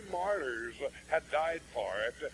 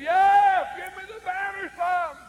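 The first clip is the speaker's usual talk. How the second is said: piercing, high-pitched full-volume yell; yelling old lady; 10 dB louder than usual. Retold or imitated. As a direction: holler it high at the top of an old lady's voice. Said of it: "and she yelled".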